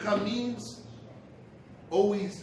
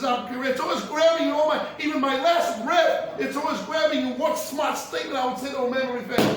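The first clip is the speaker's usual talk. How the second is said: acted anguished lament, raised and loud; mock tearful outcry; 5 semitones higher, 5 dB louder than usual. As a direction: protest as the dying rabbi, voice lifted. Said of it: "He started to cry".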